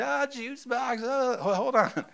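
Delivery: put-on high, slow toddler whimper; whiny little kid. Toddler whining to dad for a juice box, high. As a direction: squeak it out like a small child.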